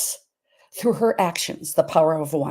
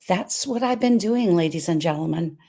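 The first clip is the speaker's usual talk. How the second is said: normal talking voice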